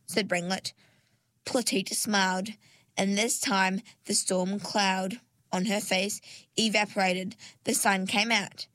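ordinary delivery, nothing marked

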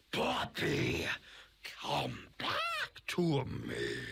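low, slow, eerie robot voice; creepy robotic voice. Acted. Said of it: "said a creepy robotic voice".

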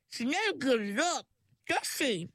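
a wry little aside; talking handbag. A narrator's reading. Handbag commenting on Perdita to Ringlet, lightly high. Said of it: "said the handbag".

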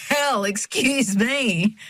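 high, long drawn-out sing-song retort; amused mock offence. A narrator's reading. Checkout girl teased over a name, high drawn-out mock apology. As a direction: stretch it out high, playfully affronted. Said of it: "Amy laughs".

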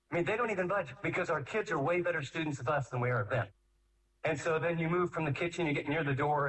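ordinary talk, nothing marked

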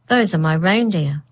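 usual speaking voice

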